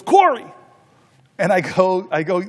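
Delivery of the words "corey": high, loud cry of the name; delighted shout. Retold or imitated. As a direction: call the name out with big delight. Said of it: "and he goes".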